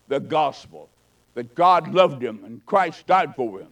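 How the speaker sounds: ordinary delivery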